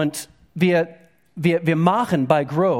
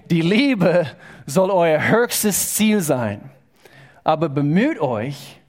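scripture reading; slower than usual. Real, not acted. Reading Bible verse aloud.

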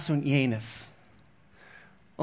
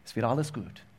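leiser, tiefer, tröstend; noch sanfter; 5 semitones lower, 7 dB quieter than usual. Real, not acted. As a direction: wie ein Trost ganz nah. Repeats more softly. („alles“ leise und tief betont)

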